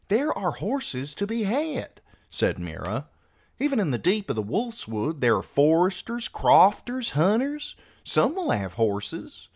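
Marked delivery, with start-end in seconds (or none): 0.1-2.0 put-on high, slow girl's counterargument; measured, high-pitched young girl; insistent sister. Voices Meera countering her brother. 3.6-9.5 put-on spirited, high-pitched young girl protesting; insistent sister. Voices Meera arguing for horses.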